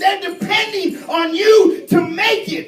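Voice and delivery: loud, high preaching shout; preaching at full force. Acted. Preacher urging the church to fight, full-force shout. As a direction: whoop it to the congregation.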